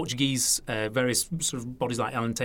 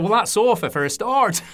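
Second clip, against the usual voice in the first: louder, much higher Lancashire punchline; Lancashire accent; 8 semitones higher, 6 dB louder than usual. Retold or imitated. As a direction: deliver the twist in a broad Lancashire voice. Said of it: "So it's my Lancashire accent there".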